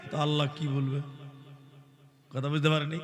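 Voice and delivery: ordinary talk, nothing marked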